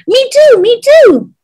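excited, high-pitched plea; eager child. A narrator's reading. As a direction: pipe up like a keen small boy. Boy eagerly joining in.

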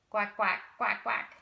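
quacking like a duck; duck quack imitation. Shown as a demonstration. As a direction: quack for the kids. Demonstrating duck sound.

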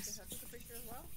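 fast unvoiced whisper. Real, not acted. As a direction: hush it out quickly. Whispering during ghost hunt.

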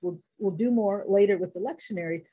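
normal talking voice

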